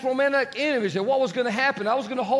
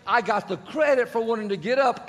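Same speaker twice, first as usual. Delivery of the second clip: normal talking voice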